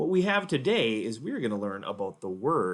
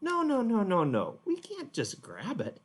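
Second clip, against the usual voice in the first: gentle correction; 9 semitones higher than usual. Pretend. Teacher gently correcting grabbing.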